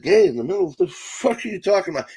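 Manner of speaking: high-pitched, incredulous comeback; baffled disbelief. Retold or imitated. Comic retelling his reply to the son, mock disbelief. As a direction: demand an explanation in a high, mock-indignant voice. Retold baffled reaction to a joke.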